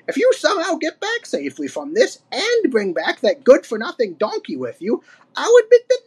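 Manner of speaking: put-on high-pitched old codger, emphatic; crotchety old ape. A narrator's reading. Old ape setting Diddy terms, crabby warning.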